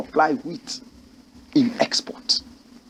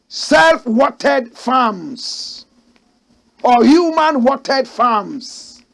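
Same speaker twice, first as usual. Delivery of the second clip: very slow, higher and louder on each word; slow emphasis; 7 semitones higher, 5 dB louder, much slower than usual. Real, not acted. Host spelling out the farming options, slow and loud. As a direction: stretch out both phrases. Host stressing key phrase slowly.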